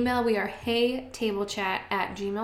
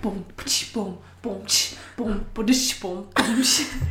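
beatboxing; 3 dB louder than usual. Acted. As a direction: mouth drum beat. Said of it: "beatboxing before the rap".

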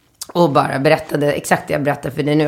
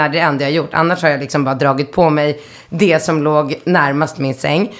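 normal talking voice

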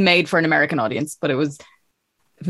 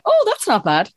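much higher, pleasantly surprised; impressed; 11 semitones higher than usual. Retold or imitated. Guest recalls seeing host's Irish accent video, high impressed surprise. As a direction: sound genuinely impressed. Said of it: "I remember being like".

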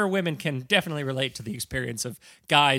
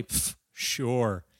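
soft dismissive sure; scoffing sarcasm; 2 semitones lower, 6 dB quieter than usual. Acted. Security guard scoffing.

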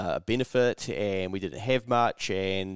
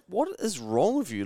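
higher, lightly voiced girlfriend scolding; a hint of a girlfriend's disbelief; imitating exasperated girlfriend; 8 semitones higher than usual. Girlfriend scolds him over the price, mildly exasperated. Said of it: "She was like".

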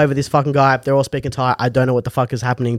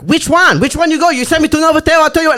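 high-pitched, badgering driver; exasperated driver; 13 semitones higher, faster than usual. Retold driver demanding to know which hotel, exasperated. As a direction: pester in a much higher voice.

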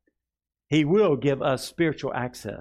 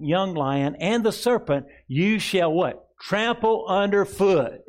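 raised, fervent preaching voice; 4 semitones higher, 4 dB louder than usual. Acted. Pastor preaching the psalm to the congregation with fervour. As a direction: preach it with rising fire.

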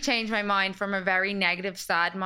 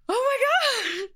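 much higher, louder and slow, drawn-out amazement; shocked exclamation; 5 dB louder, much slower than usual. Retold or imitated. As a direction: gasp it out in slow disbelief. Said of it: "she was like".

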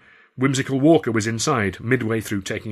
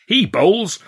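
high, loud announcement; calling out; 10 semitones higher, 8 dB louder than usual. A narrator's reading. Friend volunteers the narrator as bowler, calling out. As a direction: call it out to the whole ring. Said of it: "Lance called out".

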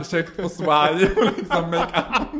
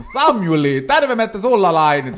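loud, drawn-out Finnish holler; ropande pappa; 5 semitones higher, 9 dB louder, slower than usual. Acted. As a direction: holler like a father through the letterbox. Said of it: "han börjar ropa i brevinkastet". (ropar högt på låtsasfinska)

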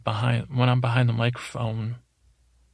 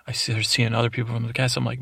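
usual speaking voice

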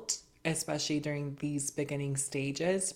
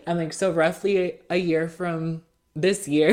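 talking through laughter, louder; laughing; 8 dB louder than usual. Real, not acted. Guest laughs while telling the host the timeline, laughing loudly. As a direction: keep laughing while correcting the date.

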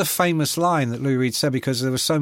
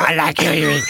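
[name] impression; 5 dB louder than usual. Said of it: "Did you have that deep voice?".

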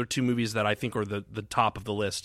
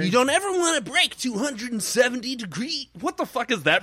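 pitched-up snooty film geek; film nerd voice; 12 semitones higher, 3 dB louder than usual. Acted. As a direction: lecture like a smug camera nerd. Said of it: "That's your film nerd voice".

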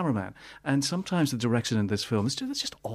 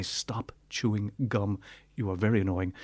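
slow, measured mock reprimand; slow telling-off; 3 semitones lower, 3 dB quieter than usual. Reviewer imagines scolding the gum-chewing bad guy, slow telling-off. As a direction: spell out the mock complaint slowly, word by word.

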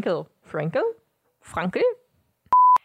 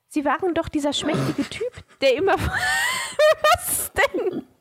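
high voice; 10 semitones higher, slower than usual. Acted. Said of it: "Zu hoch?".

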